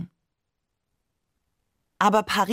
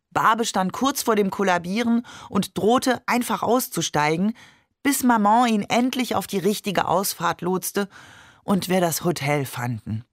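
plain reading voice

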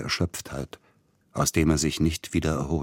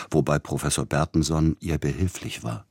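ordinary delivery

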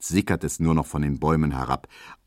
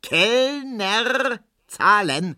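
very high, carrying shout to the waiter; drawn-out high call across the garden; 3 dB louder, much slower than usual. A narrator's reading. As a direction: call out insistently for the bill. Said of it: "ruft Herr Ziebland nochmals".

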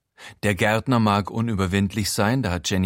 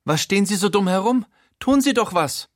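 high, hurried screech; kreischend; 11 semitones higher, 3 dB louder than usual. A narrator's reading. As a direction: shriek an impatient order at the gardener. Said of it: "Schließlich kreischt sie in Richtung Gärtner". (hohes, aufgebrachtes Kreischen)

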